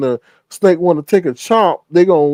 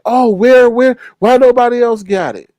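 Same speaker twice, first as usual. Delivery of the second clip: mock indignant; 6 semitones higher, 5 dB louder than usual. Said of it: "then they're like".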